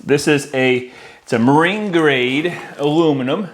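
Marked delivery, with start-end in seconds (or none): none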